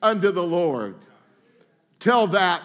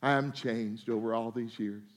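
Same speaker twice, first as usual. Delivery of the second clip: meek confession; 9 semitones lower, 7 dB quieter than usual. Retold or imitated. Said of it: "He was saying".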